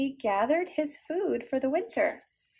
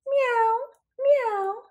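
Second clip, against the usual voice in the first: high meowing cat; 9 semitones higher than usual. Acted. Storyteller voicing Cat for children, high meow. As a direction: meow it like a kitten. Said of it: "said Cat".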